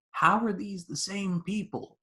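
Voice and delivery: high-pitched amazed question; incredulous. Retold or imitated. Viewer amazed at one actor's two roles, incredulous. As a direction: pitch it up in mock disbelief.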